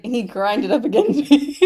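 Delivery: giggling through a retold complaint; laughing quote. Friend laughing while quoting Phoebe Berman, loud and slow. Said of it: "It's like Phoebe Berman when she's like".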